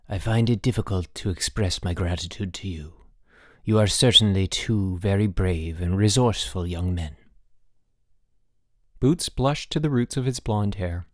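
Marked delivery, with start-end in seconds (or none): 0.1-7.2 dignified ambassador. A narrator's reading. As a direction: introduce yourself with a touch of solemn dignity.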